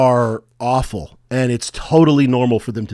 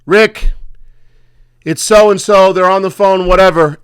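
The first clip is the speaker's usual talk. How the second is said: higher, louder call across the shop; calling out to Rick; 5 semitones higher, 6 dB louder than usual. Retold or imitated. The woman at the pizza shop calls for Rick, loud call. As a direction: yell for the boss over the noise. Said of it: "and she goes".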